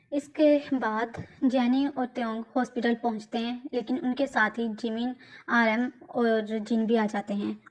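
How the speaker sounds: plain reading voice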